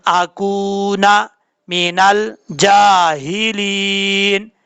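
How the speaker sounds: very slow recitation, vowels held long and level; practice Quran recitation. Shown as a demonstration. Teacher leading students in practice, slow measured Quran recitation. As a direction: recite phrase by phrase for learners to repeat. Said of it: "practice के तौर पे पढ़ते हैं".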